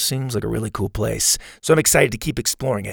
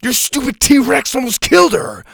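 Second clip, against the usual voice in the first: much higher, loud accusing cry; angry yell; 11 semitones higher, 5 dB louder than usual. A narrator's reading. Ash blaming Bo for the attack, angry yell. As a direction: blame him, voice cracking with upset.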